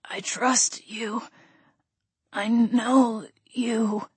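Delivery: unvoiced, very slow breathy whisper; dying man whispering his trust; fading whisper. A narrator's reading. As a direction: let the words die away. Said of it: "his voice fading with every word".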